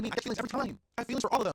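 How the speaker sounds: high, loud, animated comeback; emphatic confession. Retold or imitated. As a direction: own up to it proudly, pitch jumping up. Emphatic answer to imagined question.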